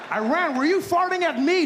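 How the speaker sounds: high, loud bully demand; high accusing shout. Pretend. America confronts Iran, high loud demand.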